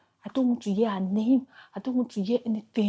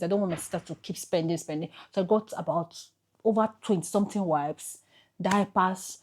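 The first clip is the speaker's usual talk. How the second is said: ordinary talk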